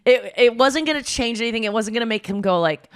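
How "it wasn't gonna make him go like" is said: usual speaking voice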